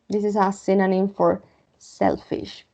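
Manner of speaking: usual speaking voice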